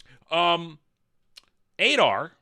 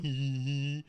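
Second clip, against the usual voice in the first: quiet mock titter to himself; imitated giggle; 4 semitones lower, 8 dB quieter than usual. Entranced Celebrimbor while everybody runs around, imitated soft giggle. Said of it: "He's going".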